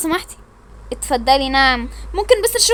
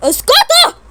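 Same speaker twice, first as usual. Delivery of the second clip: shout to silence; 13 semitones higher, 8 dB louder, slower than usual. Pretend. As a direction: bark them into silence. Girl shouts the others quiet.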